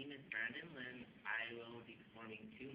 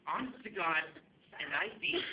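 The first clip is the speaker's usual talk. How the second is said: loud, raised-pitch swearing it's true; emphatic insistence; 6 semitones higher, 11 dB louder than usual. Acted. Actor swearing the plane story is true, loud and emphatic. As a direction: insist on it over the commotion. Insists after comic outburst.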